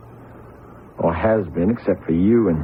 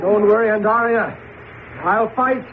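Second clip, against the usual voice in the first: desperate resolve. Acted. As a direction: fight for her in a panic. Moses fights for Andaria.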